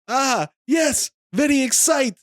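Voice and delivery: high, mock Kazakhstani accent, drawn out at the end; excited foreigner in a hammed-up Kazakhstani accent; Borat's Kazakhstani accent. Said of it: "He's doing a, a Kazakhstani accent".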